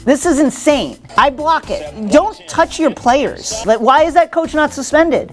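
high-pitched comic outrage; worked-up, incredulous host rant; mock indignation. Host riffs indignantly on a slap.

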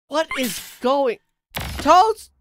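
high, loud squawk of surprise; shocked, high-pitched exclamation; startled disbelief. Real, not acted. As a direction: burst out high and loud, incredulous. Startled by toast in game.

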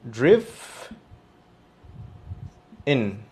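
"driven" split into "driv", long pause, "en"; syllable-by-syllable model. Shown as a demonstration. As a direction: sound out "driv... en" with a short i. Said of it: "sería así".

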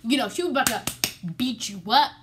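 loud, emphatic warning; teacher's warning to Amy, loud. Retold or imitated. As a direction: warn her mom is coming. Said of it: "in other words".

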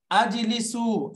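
আজলিসু said slowly and clearly; slow model word. Shown as a demonstration. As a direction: spell out আজলিসু slowly for the class. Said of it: "এই দেখেন". (ধীরে স্পষ্ট করে আজলিসু)

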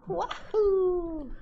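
high cartoon plumber whoop; squeaky video game hero yell; Mario cheer.

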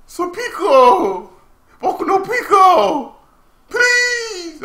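hammed-up high, slow wail; mock pleading. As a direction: wail in joking, squealed desperation. Joking pleas after laughing.

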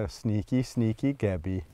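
low, soft sing to a sneaking animal; hushed teasing. Real, not acted. Keeper spotting sneaky Gabby coming, hushed. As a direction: murmur it low and very softly.

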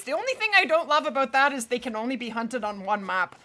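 usual speaking voice

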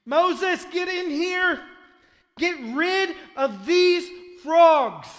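high, drawn-out full-voiced yell; furious summons. Pharaoh ordering Moses to clear the frogs, exasperated yell. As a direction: bellow for help at the end of your rope.

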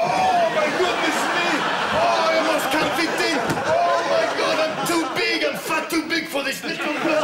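very high, over-the-top moaning cries; mock bedroom ecstasy. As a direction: wail it high in hammed-up passion. Mimics lover while squeezing through door.

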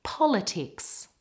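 "Politics" with stress on the first part; first-syllable stress. Shown as a demonstration. Teacher models the last word, first-syllable stress on "Politics". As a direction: hit the opening syllable of "Politics". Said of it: "stress is on the first part".

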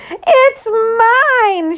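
high, loud claim; yelling. Acted. Ruthie yelling at Martin in front of the teacher. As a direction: shout it to win the argument. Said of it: "she yelled".

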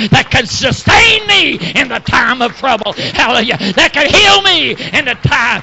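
fervent preaching, rising into shouted peaks; full-force preaching. Real, not acted. Preacher testifying to the congregation about holy joy, full-force preaching. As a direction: preach it hard, punctuated with hallelujahs.

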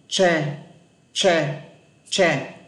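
"Tre" as isolated Vietnamese word, retroflex tr. Shown as a demonstration. Teacher shows students the Vietnamese comparison sound, clearly demonstrated "Tre". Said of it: "Ví dụ tiếng Việt nhé".

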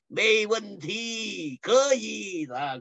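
slow, lightly voiced Chinese lady; a hint of a cheerful buyer. Lady buyer agrees to take the quails, lightly voiced. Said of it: "It says".

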